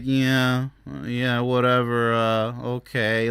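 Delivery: low, slow, droning indifference; listless. Acted. Imitating people who are too quiet and unhappy, listless drone. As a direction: mimic someone with no energy, dragging it out. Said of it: "people who are too quiet, they're like".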